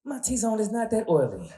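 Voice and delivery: put-on high, offended girl voice; sweet girl voice. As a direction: protest high and indignant. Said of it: "sweet girl's voice".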